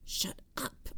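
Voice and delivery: hushed, voiceless whisper. A narrator's reading. Narrator hushing Jamie mid-standoff, whispered. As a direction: whisper it under your breath. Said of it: "I whispered".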